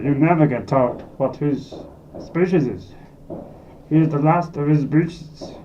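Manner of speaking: ordinary delivery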